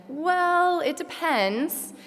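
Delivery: playful take on the teachers' stock answer; imitating a lecturer. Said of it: "Your favorite answer to our question".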